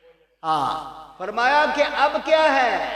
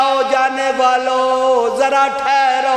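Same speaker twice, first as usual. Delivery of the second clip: slow, loud plea through tears; tearful call; 3 semitones higher, 6 dB louder, slower than usual. Acted. Weeping Ibrahim calls to the departing men, a slow loud plea. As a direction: call them back, pleading and slow. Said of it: "آنکھوں سے آنسو برس رہے ہیں".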